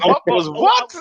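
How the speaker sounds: a mock-confused snap back; baffled retort. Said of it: "I said".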